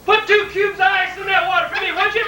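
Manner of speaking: high, loud yell down the hall; dad yelling. Retold or imitated. Dad yelling to his son for ice, high and loud. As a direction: holler a demand from the bedroom. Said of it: "you could hear him yell".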